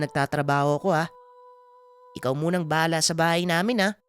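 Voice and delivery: Aming. Acted. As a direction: thank and befriend the creature, voice lifted. (mabait na binatang nakikiusap sa nilalang, bahagyang mataas ang tono)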